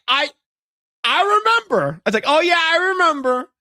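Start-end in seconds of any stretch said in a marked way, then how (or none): 0.1-2.0 very high, strained reply; uneasy playacted reply. Employee humoring his marching colleague, high-pitched uneasy reply. 2.3-3.5 very high, strained reply; uneasy playacted reply. Employee humoring his marching colleague, high-pitched uneasy reply.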